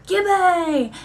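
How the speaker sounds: calling out a nickname. Retold or imitated. Pointing at a seventh grader, blurting his new nickname loud. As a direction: blurt the nickname out across the room.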